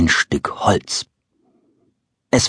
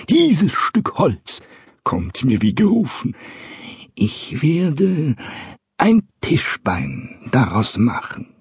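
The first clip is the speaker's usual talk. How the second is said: put-on higher, slow, delighted old carpenter voice; pleased grumbling carpenter; 6 semitones higher, slower than usual. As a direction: mumble it like a pleased old craftsman. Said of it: "brummelte vor sich hin".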